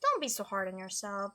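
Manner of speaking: ordinary talk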